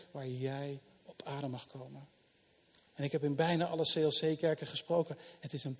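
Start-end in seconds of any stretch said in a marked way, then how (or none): 0.1-2.1 much softer, sinking low on adem; soft and gentle. Acted. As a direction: let it settle quietly like a breath. Gentle invitation to rest. (zacht en rustig, "adem" laag uitgesproken)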